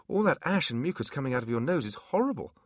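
prissy little imp complaint; high imp voice. Acted. Imp character voice.

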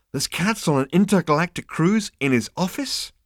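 can't make sense of it; baffled Zaphod. Acted. Zaphod baffled by the receptionist's answer, incredulous.

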